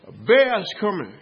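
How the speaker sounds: acted warning shout. Preacher imagines warning someone in the woods, acted warning shout.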